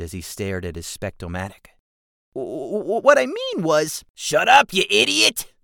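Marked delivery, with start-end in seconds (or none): 2.3-4.0 stammering goblin. Acted. Stammering character. 4.1-5.5 sharp goblin snap. Acted. Jigsaw silences Keswick.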